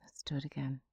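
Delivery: soft unvoiced breathing guidance; guided meditation whisper. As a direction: whisper them calmly through each breath.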